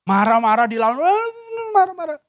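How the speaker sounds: rising, high mock rant; mock angry retort. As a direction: imitate anger fired back at anger. Mimics answering anger with anger. (pura-pura membalas marah, nada melengking)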